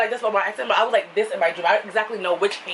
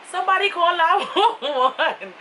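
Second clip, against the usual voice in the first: high, slow, half-laughing cry for help; mock panicked plea; 7 semitones higher, slower than usual. Dangling outside the window, calling to the news reporter in mock panic. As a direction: plead for help while laughing at yourself. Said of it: "I'm like".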